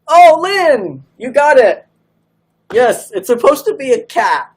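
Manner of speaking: high, loud, thrilled exclamation; excited. Real, not acted. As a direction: burst out with delight. Excited a viewer guessed right.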